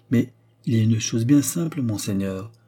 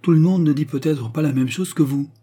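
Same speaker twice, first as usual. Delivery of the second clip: higher and louder, heated reproach; vehement; 6 semitones higher, 5 dB louder than usual. A narrator's reading. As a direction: throw it at her with vehemence. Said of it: "avec véhémence".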